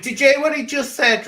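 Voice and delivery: high, loud and delighted; excited. Real, not acted. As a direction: burst out in surprise. Excited at Ronan's remark.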